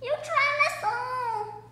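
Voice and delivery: high, drawn-out wail of accusation; shouted shriek. A narrator's reading. Panicked woman on the rocking lifeboat, shrieking. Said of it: "A wo-, woman shouted".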